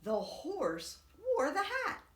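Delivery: usual speaking voice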